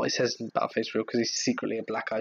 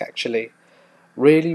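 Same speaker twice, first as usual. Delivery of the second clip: usual speaking voice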